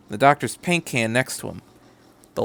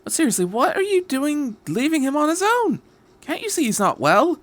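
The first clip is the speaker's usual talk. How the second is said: exasperated. Acted. Doctor scolding passengers.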